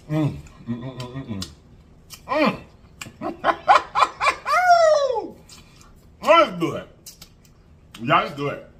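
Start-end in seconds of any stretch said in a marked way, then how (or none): 3.6-5.3 high laugh. Real, not acted. Laughs while eating.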